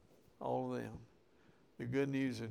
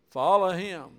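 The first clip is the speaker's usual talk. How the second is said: preaching exhortation; 5 semitones higher, 10 dB louder than usual. Real, not acted.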